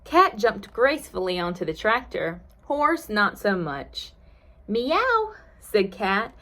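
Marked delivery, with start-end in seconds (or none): 4.7-5.3 high meow; cat meow. A narrator's reading. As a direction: mew like a cat, pitched high. Cat sound in story.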